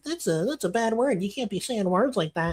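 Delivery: high, soft scolding voice; mock prissy telling-off; YouTube. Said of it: "YouTube is like".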